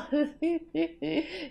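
short nervous giggle; nervous laugh. Real, not acted. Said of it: "c'est un rire nerveux".

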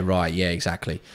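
usual speaking voice

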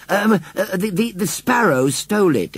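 high, hesitant stammering; stammered excuse. Acted. Boy explains himself to the farmer, stammering.

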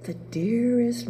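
ordinary delivery

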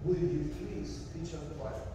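usual speaking voice

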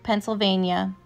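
usual speaking voice

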